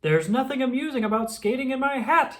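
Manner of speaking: mild put-out villain; annoyed. Acted. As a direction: huff at the penguins. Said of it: "he said".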